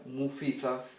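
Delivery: "mufizaf" dicho claro, con acento en "fi"; pronunciación modelo. Shown as a demonstration. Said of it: "se pronuncia". ("mufizaf" dicho claro, acento en "fi")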